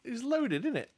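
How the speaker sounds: playful, high-pitched teasing about the cash; excited tease. Real, not acted. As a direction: voice jumps high on loaded. Teasing friend about his money.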